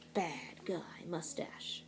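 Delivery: slow, hushed villain whisper; sinister whisper. A narrator's reading. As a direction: breathe it out like a sneaky bad guy. Villain reveal in read-aloud.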